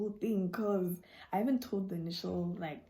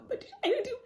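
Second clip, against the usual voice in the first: high squeaky laughing protest; giggling disbelief, much higher; 5 dB louder, faster than usual. Retold or imitated.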